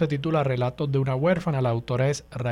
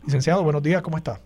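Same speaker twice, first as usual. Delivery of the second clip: saludo alto; 4 semitones higher, 6 dB louder, much faster than usual. Real, not acted. Saluda al invitado por teléfono.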